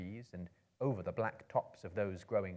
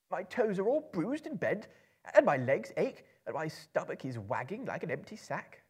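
much higher, complaining hobbit; whining hobbit; 9 semitones higher, 5 dB louder than usual. A narrator's reading. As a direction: moan about every ache. Bilbo complains of aches.

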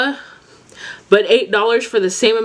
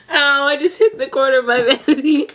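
giggling through a high ouch; laughing; 8 semitones higher than usual. Real, not acted. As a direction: laugh it off, pitched up. Laughing after hitting vanity.

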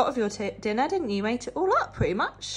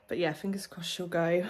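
normal talking voice

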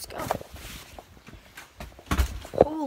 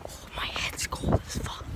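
hushed whisper inside a store; 7 dB quieter than usual. Real, not acted. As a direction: whisper to stay quiet. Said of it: "I'm gonna keep quiet".

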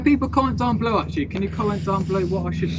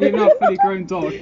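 laughing tease; 8 dB louder than usual. Real, not acted. Teasing John as dog.

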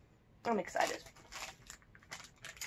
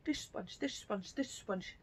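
tongue twister fast; 2 semitones lower, 12 dB quieter, slower than usual. Shown as a demonstration. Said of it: "Say that three times fast".

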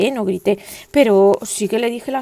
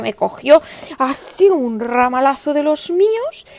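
ordinary talk

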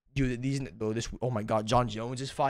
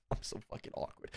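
hushed, sheepish under-breath admission; soft awkward whisper to the camera; whispered embarrassment; 12 dB quieter than usual. Real, not acted. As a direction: mutter it like you're embarrassed.

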